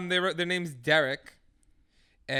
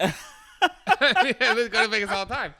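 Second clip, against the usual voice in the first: laughing brag; 6 semitones higher, 6 dB louder, slower than usual. Pretend. Joking brag while laughing.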